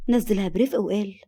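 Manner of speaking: usual speaking voice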